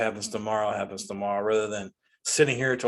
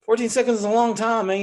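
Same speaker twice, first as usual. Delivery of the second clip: higher and louder, fed-up protest; exasperated outburst; 10 semitones higher, 5 dB louder than usual. Real, not acted. Ranting to listeners about skipping ads, exasperated and loud. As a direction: throw your hands up over the wait.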